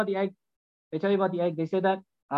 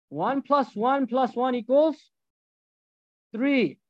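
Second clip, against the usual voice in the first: dumbed-down math; 3 semitones higher, much slower than usual. Shown as a demonstration. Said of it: "You're gonna do dumb math".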